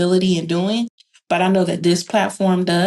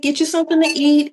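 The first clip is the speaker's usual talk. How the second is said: higher, quick mothering nudge; quick caring nudge to eat; fussing care; 8 semitones higher, faster than usual. Retold or imitated. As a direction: fuss over her like a friend. Retells caring for her stressed friend.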